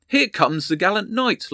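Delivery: ordinary delivery, nothing marked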